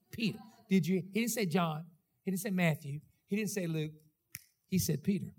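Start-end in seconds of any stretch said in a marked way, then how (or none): none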